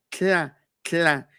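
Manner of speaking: tla with air past the sides of the tongue; tongue held still, say tla; lateral tla. Shown as a demonstration. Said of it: "con los lados de la lengua decimos".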